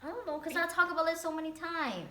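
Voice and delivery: high-pitched, upset confession; real upset, voice pushed up high; tearful. Real, not acted. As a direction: say it close to tears, pitched high. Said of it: "for some reason I just cry".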